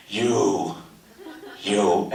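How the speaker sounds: very low, drawn-back mock threat; menacing singling-out. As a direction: drop deep and single him out. Acted telling-off of the cameraman.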